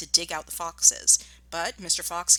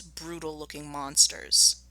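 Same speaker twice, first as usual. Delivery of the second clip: ordinary delivery, nothing marked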